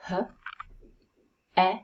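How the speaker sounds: H and e sounded one by one; single sounds H... e, widely spaced; sounds in isolation. Shown as a demonstration. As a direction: breathe out H, pause, then a short e as in egg. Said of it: "look at the sounds in the first syllable".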